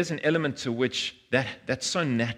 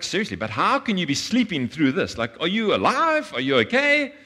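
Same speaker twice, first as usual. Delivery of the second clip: climbing much higher with each question; astonished disciples, pitch shooting up; amazed disbelief; 7 semitones higher, 4 dB louder than usual. Retold or imitated. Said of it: "They're like".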